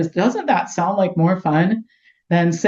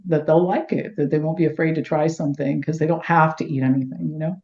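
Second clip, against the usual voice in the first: ordinary delivery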